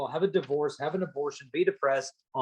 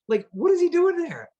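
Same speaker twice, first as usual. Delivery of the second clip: high, loud disbelieving question; baffled, pitch shooting up; incredulous; 14 semitones higher, 5 dB louder than usual. Real, not acted. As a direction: speaker baffled at hiding friend, high disbelief. Speaker's incredulous aside.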